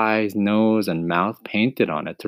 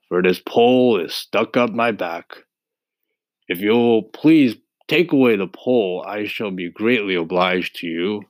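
husky scarecrow. Acted. Voices the scarecrow.

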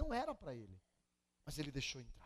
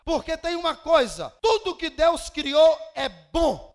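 fiery preaching; 7 semitones higher, 15 dB louder than usual. Acted. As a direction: thunder the verses out to the congregation. Preaching scripture at full force.

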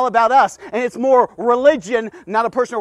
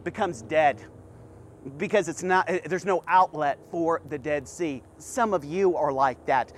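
quiet earnest preaching; 4 semitones lower, 4 dB quieter than usual. Acted. Preacher confronting the congregation, quiet and earnest. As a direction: lower and softer, confiding sermon voice.

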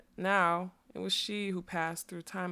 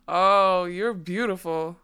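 drawn-out, lightly voiced man's awe; a hint of a wonderstruck man; awestruck fat man; 6 dB louder, slower than usual. A narrator's reading. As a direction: marvel slowly at a beautiful creature. Fat man beholds unicorn in awe.

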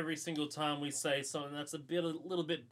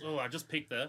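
ordinary delivery

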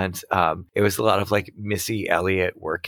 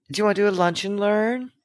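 high chirpy office voice; sugary sweet coworker; 11 semitones higher than usual. Retold or imitated. Mocking coworker.

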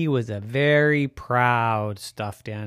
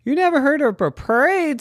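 high surprised goose voice; 14 semitones higher, 5 dB louder than usual. Acted. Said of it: "said Ricardo".

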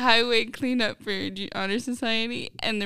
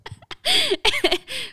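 a loud, high laugh burst; high burst of laughter; 6 dB louder than usual. Real, not acted. Laughs at the rat shout-out.

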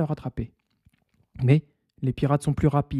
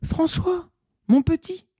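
very high emotional cry; queen's cry. Acted. Queen calling her long-lost son, very high cry. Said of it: "la reine crie".

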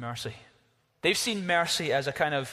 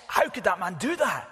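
higher, indignant outcry; a retold indignant outcry, higher; upset outcry; 4 semitones higher than usual. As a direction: cry out in outrage at the verdict. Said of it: "you'd be so upset".

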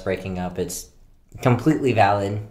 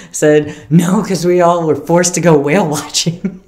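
laughing out the punchline; high, loud, cracking up; 8 semitones higher, 9 dB louder than usual. Real, not acted. Punchline delivered through laughter.

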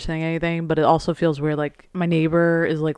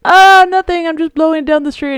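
high, loud, innocent mock voice; hammed-up innocent neighbor; 12 semitones higher, 9 dB louder than usual. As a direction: play the clueless neighbor, high and bright. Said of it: "And he's like".

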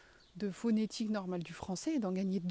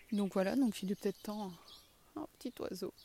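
ordinary delivery

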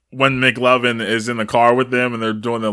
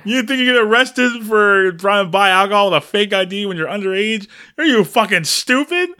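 mock rant; 7 semitones higher, faster than usual. Ranting at character's stupidity.

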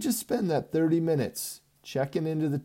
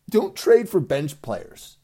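slow, loud, each word spaced; emphatic rule; 4 semitones higher, 8 dB louder, slower than usual. Real, not acted. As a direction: lay down the law slowly.

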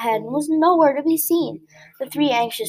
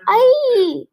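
sudden, much higher, louder shriek of fright; 11 semitones higher, 6 dB louder than usual. A narrator's reading. Flap crashing in the dark, a frightened shriek. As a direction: shriek like a falling bird. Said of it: "shrieked Flap".

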